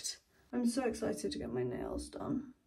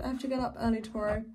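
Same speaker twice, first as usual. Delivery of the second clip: ordinary delivery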